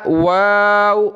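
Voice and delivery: واو said slowly with rounded lips. Shown as a demonstration. Teacher showing pupils the lip shape of واو. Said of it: "واو پر ہونٹ گول کرتے ہیں".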